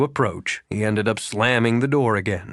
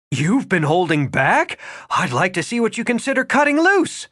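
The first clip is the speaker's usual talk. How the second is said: a woman's voice, higher, pushing back at him; Regan, indignant; 8 semitones higher, 4 dB louder than usual. A narrator's reading. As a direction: challenge him, half disbelieving.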